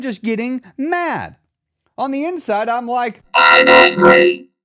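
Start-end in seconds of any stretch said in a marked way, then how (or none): 3.3-4.5 very loud, low monotone robot effect; drawn-out electronic robot announcement. Shown as a demonstration. Host demonstrating anger with a button, robot voice. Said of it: "I want the robot voice".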